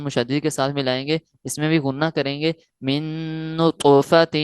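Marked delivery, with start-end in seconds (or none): 3.6-4.3 نطفۃ louder, the ط bounced with qalqala; qalqala recitation. Shown as a demonstration. Teacher showing students: نطفۃ with a louder qalqala ط. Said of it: "یہاں قلقلہ ہوگا".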